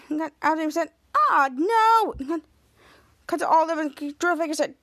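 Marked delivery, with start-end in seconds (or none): 1.1-2.1 higher wail of dismay; alarmed cry. Retold or imitated. Elderly woman facing capture, alarmed. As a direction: act out an alarmed reaction.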